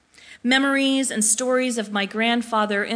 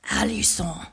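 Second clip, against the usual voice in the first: put-on low, rough old man's voice; deep gravelly voice; 5 semitones lower, 3 dB quieter than usual. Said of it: "he says in a very deep and gravelly voice".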